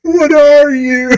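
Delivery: high, slow, loud mock wail; mocking his sob. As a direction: ham up his sobbing question. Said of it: "mocking his sobbing line".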